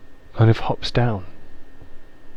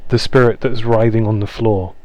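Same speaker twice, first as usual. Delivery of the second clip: usual speaking voice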